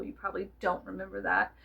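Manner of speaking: ordinary delivery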